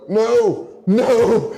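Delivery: a hammed-up creature whining no; mock pleading Jin.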